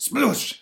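high mock spit-take; cartoon splash noise; spitting it out. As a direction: imitate a spray of spat-out drink. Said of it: "spitting it out".

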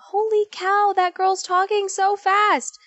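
high, drawn-out mock amazement; playfully amazed at herself; acted astonishment. Acts out reaction to own calls.

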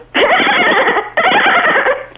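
very high squeaky dolphin squeal; dolphin noise. Pretend. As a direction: chirp it out like a dolphin. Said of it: "can we have a dolphin noise now?".

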